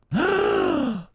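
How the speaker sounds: high startled gasp; sharp gasp. Real, not acted. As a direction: gasp in sudden fright. Sudden gasp at near fall.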